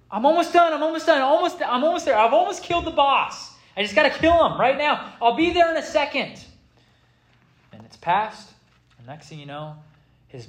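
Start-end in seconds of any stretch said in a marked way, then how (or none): 0.1-6.4 put-on high, pleading gamer kid; kid stalling again. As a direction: push the voice way up, begging. Son stalling mom again.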